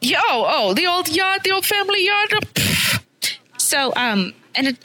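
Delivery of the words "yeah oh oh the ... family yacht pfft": high, breezy mock posh yacht talk. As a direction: toss off the family yacht, higher. Mocking rich kid yacht brag.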